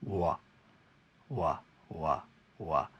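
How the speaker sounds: slow wah, back of tongue lifted; W sound demo. Shown as a demonstration. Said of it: "like this illustration".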